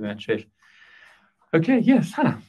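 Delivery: ordinary talk, nothing marked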